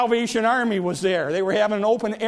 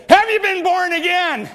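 put-on high, louder old man's voice; a higher, louder old churchgoer's voice; old man in the back; 9 semitones higher, 5 dB louder than usual. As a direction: call out high and sharp from the back pew. Old man asks third time.

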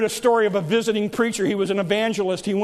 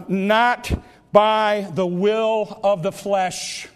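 drawn out very slowly, word by word; slow deliberate scripture reading; slow solemn note; much slower than usual. Acted. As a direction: read it out as if writing it down slowly. Evangelist writes second note.

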